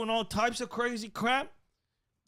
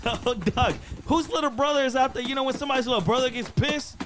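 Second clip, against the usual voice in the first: higher, louder giddy commentary; excited loud; 6 semitones higher, 4 dB louder than usual. Real, not acted. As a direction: call it out, thrilled.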